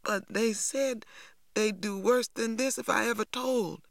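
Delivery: high-pitched tearful protest; crying, frightened voice pitched well up; starting to cry. A narrator's reading. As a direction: plead it on the edge of tears. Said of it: "T J began to cry".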